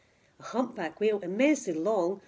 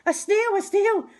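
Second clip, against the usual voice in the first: much higher, louder startled shriek; the teacher spots the snail and panics; alarmed cry; 11 semitones higher, 7 dB louder than usual. Acted. Teacher spots a snail on the board, startled cry.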